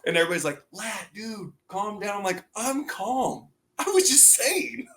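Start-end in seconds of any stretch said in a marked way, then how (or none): 0.7-2.2 hushed, higher aside; soft retold warning; imitated calming teammates. Teammates quietly calming him, retold. 2.5-4.9 high, slow mock-calm voice; squeaky quoted self-defence; retold innocent protest. Retold defensive reply to teammates.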